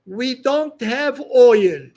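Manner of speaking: ordinary talk, nothing marked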